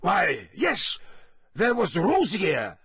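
high, anxious blurt; hurried. A narrator's reading. Karkaroff offering Crouch another name, hurried. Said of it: "said Karkaroff hurriedly".

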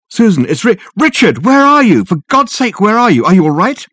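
desperate, urgent asking; frantic worry. Acted. Frantic call to missing Susan.

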